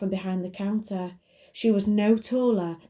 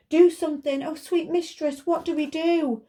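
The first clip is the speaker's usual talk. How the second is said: panicky magic lamp voice; 9 semitones higher than usual. Acted.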